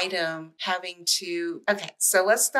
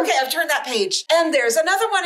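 high, louder gleeful reading; hammy excitement; 9 semitones higher, 5 dB louder than usual. Acted. Retelling a picture book to a friend, gleeful high voice. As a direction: keep the giddy ham going.

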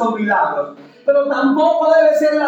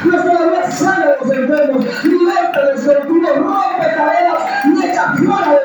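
fervent preaching; 5 semitones higher than usual. Real, not acted. Pastor rousing the congregation, fervent high preaching. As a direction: lift the church up, preach it out.

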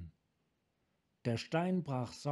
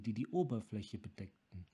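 usual speaking voice

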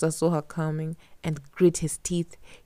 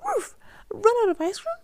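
much higher astonished cry; can't believe it, squeak it out; shocked Katya. A narrator's reading. Katya hearing the ice is running out, high shocked cry.

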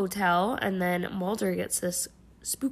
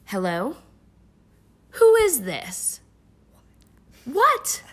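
cheesy overacted phone call, much higher, louder; stilted old movie melodrama; 6 semitones higher, 8 dB louder, slower than usual. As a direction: ham up a bad TV actor's shock. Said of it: "I'm gonna pretend to do it".

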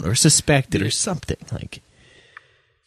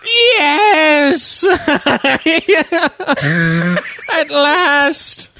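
laughing villain voice; 3 dB louder, much slower than usual. Pretend. Mock villain demanding the hustler.

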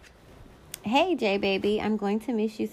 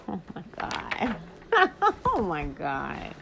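laughing. Real, not acted. Laughing at a yearbook note.

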